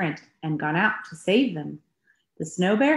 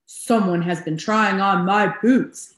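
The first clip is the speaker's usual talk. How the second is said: a bit louder big bear voice; booming Papa Bear; 5 dB louder than usual. A narrator's reading. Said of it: "he boomed in his big bear voice".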